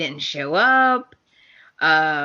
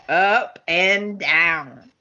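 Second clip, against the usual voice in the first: each word stretched, dwelling on Up and down; teasing, lingering Up and down; slow, drawn out; much slower than usual. Shown as a demonstration. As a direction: savour Up and down slowly for effect.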